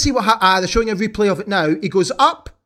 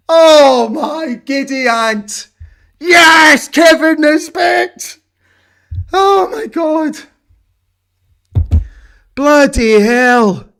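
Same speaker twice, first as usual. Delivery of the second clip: slow, higher exclamations, the loud ones cracking; stunned joyful cries; 7 semitones higher, 5 dB louder, much slower than usual. Real, not acted. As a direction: gasp it out in overwhelmed joy. Stunned joy after winner.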